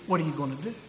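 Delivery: asked very softly, almost under the breath; soft, intimate rhetorical question; hushed question. Real, not acted. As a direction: drop to a hush and ask it quietly.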